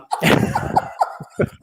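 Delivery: airy, unvoiced burst of laughter; high breathy giggle. Real, not acted. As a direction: laugh at your own slip. Laughing at own flub.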